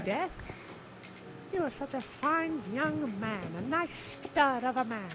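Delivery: high, hammed-up flirtatious voice; high flirty character voice. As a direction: flirt like a silly damsel. Roleplaying flirting with Death.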